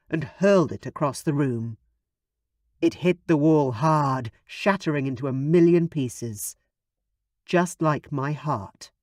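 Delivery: ordinary delivery, nothing marked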